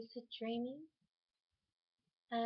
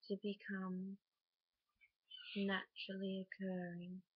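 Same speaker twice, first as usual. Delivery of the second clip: guided meditation. Acted. Guiding a listener to picture a flame before sleep, calm meditation voice. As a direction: lead them unhurriedly into rest, phrase by phrase.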